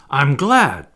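loud exclamation; glad cry. A narrator's reading. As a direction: cry it out with relief. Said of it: "Peter cried".

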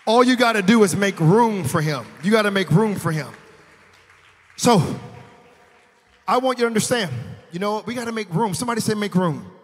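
loud, high preaching voice; rising shout of praise; preaching at full force. Acted.

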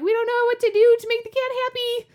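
high, loud mock-desperate whine; frantic kids' wail. Retelling kids' panic over cat.

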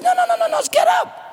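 high, pleading no's from the king; urgent gentle king. Acted. King urges the trembling boy, high and pleading. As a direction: lift him up with high urgent reassurance.

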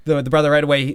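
plain reading voice

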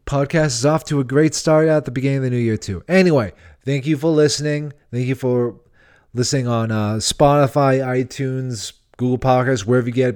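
usual speaking voice

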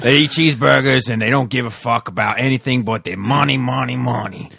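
hammed-up Aussie comedian impression; Australian accent. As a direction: rant in a put-on Australian accent. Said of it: "I don't know why I got Australian".

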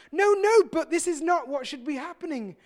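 high, loud "no, no" of objection; Peter protesting. Retold or imitated. Peter objecting as Jesus washes his feet, loud protest. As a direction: burst in to stop him.

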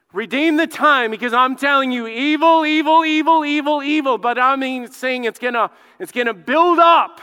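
loud, high, urgent preacher; impassioned pulpit cry; full-force preaching. Real, not acted. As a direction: hammer it out with rising urgency.

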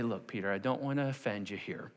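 mild, considerate reproof; gentle correction. Acted. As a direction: break it to him delicately. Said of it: "you would think Jesus would say".

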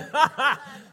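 bright burst of laughter; real laugh. Real, not acted. Pastor cracking up at his own mimicry. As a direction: laugh at yourself. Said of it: "laughing at himself".

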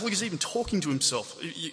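ordinary talk

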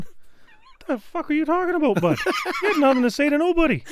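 mock exasperation. Retold exasperation at coworker.

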